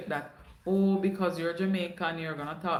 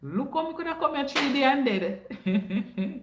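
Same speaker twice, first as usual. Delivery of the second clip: laughing disbelief; 7 semitones higher than usual. Retold or imitated. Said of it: "And I was like".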